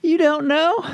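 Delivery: high, tearful Southern woman; Southern accent, crying. Retold or imitated. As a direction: wail it like a Southern mom in tears. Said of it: "they had Southern accents".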